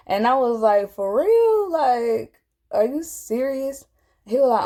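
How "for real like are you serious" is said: high-pitched retold astonishment, easing down; replaying your own stunned, high-voiced reaction; disbelieving question. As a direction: act out incredulity, starting very high. Questioning stranger's prophecy in disbelief.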